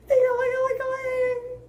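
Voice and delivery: high sing-song ringing; phone ring imitation. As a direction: sing the ringtone fast and high. Phone ring sound effect.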